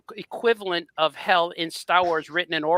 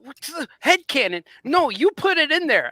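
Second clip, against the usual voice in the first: higher-pitched retold objection; indignant protest; 9 semitones higher than usual. Fan arguing back at the author, indignant. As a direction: push back, incredulous and high.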